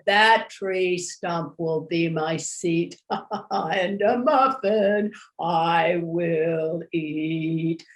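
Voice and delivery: slow, low bear voice. A narrator's reading. Bear eyeing muffins on a tree stump, low and drawn out. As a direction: draw it out low like a greedy bear.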